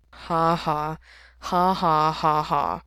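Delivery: deadpan, spoken-out ha-has; fake laughing. A narrator's reading. Mikey answering a joke, fake laughing. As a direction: fake a laugh in slow, separate syllables. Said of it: "fake laughing meant coughing".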